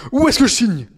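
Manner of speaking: lightly voiced eager signer; mock eager rapper. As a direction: play him grabbing the pen. Voicing rapper eager to sign away.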